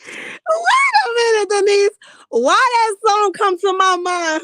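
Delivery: high, loud, slow laughing cry; laughing shriek. Real, not acted. Barney memory cracks her up.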